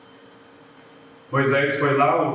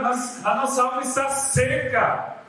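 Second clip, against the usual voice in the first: hammed-up pitiful complaint, pitched higher; mock dramatic whine; 6 semitones higher than usual. The people complaining to Moses about their dry souls, hammed-up whine. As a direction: play up the people's whining drama. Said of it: "o drama".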